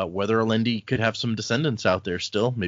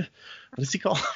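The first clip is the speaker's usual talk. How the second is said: ordinary delivery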